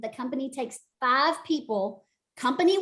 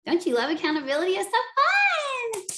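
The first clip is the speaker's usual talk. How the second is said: playful teasing; 8 semitones higher than usual. Acted. Teasing the team about accountability.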